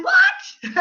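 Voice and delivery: high, incredulous yelp; mock disbelief. Coach reacting to an excuse about boundaries, mock disbelief. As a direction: squeal it as if you cannot believe it.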